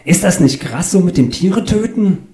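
high, wide-eyed naive question; nosy passenger. Acted. Claudius questions butcher about killing animals, high and naive.

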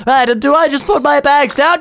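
high, prissy put-on voice for the passenger; mock fussy old lady. Mocking the woman on the train, high and prissy. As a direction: ham up a helpless old passenger.

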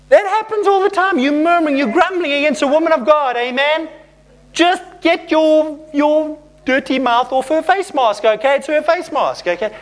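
high-pitched, insistent rebuke; emphatic scolding. Real, not acted. Preacher rebuking grumblers against a woman of God, emphatic scolding.

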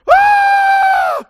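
piercing high scream of fright; terrified scream. Acted. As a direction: scream like you saw a ghost. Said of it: "the disciples screamed".

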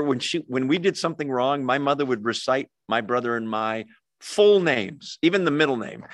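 ordinary delivery

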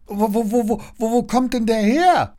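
high, loud bewildered stutter; high-pitched stammered question. A narrator's reading. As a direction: get stuck on the first word, then blurt it. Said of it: "stottert Leon weiter".

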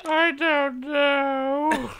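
very high, drawn-out comic wail; hammed-up bawling, loud and stretched; mock crying. Man in tears answers her, mock crying. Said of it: "She just starts crying".

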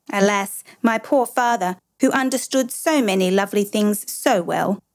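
plain reading voice

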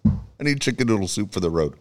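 ordinary delivery

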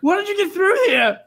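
high, eager questions; excited. Acted. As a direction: gush with surprised joy. Reggie overjoyed to hear Chip.

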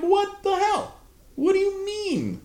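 high, incredulous yell; outraged disbelief. Retold or imitated. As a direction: cry out in shocked outrage. Retold outburst at the tow lot.

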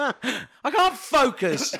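giggling through the words; can barely speak for laughing. Real, not acted. Laughing fit after joke.

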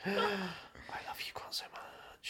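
whispered swoon with a gasp; gasp, then whisper it adoringly; breathy gushing whisper. Real, not acted. Gushing about Hugh Grant.

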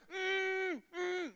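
high, loud shouted mm mm; frantic mimicked snorkel shout; loud imitated hum. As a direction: yell the hum through a snorkel. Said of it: "I'm being really loud".

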